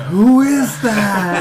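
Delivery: high, slow, loud mom voice; mock mom. Mom hearing a stranger on the headset, high mock alarm. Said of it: "Your mom's like".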